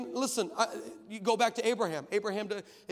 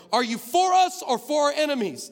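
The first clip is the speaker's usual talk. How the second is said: a high, loud yell across the field; 8 semitones higher, 11 dB louder than usual. Retold or imitated. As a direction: shout the challenge at the stranger. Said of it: "and I yelled to him".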